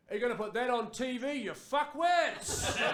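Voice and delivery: high-pitched yell from a passing car; mock heckling yell. Dude in a passing Hilux heckling, yelled out. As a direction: call it out high, dragging the final insult. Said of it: "a dude yelled out".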